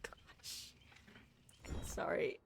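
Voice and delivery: whispered aside; whispering. Real, not acted. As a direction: whisper it away from the mic. Dealing with the cat.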